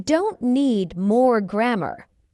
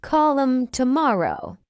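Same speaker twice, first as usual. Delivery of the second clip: slow, measured "Call 'em tomorrow"; slow model; 3 semitones higher than usual. Shown as a demonstration. Teacher models the phrase for learners first, slowly. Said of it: "fast English is not difficult".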